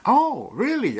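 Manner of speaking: high, loud drawn-out "Oh, really?"; surprised. Retold or imitated. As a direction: replay the shocked question. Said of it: "And that's what I said".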